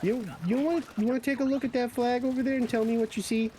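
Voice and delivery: high-pitched, fast, testing question; mock challenge. Movie Canadian challenging the visitor.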